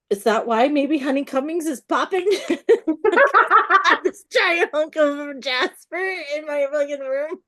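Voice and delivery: high, loud, cracking up mid-sentence; laughing. Real, not acted. Author amused by the rock in her room, laughing. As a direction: break into real laughter as you ask.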